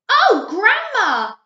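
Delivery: put-on high, loud, slow child voice; a wide-eyed little girl; high slow little girl calling grandma; girlish voice. A narrator's reading.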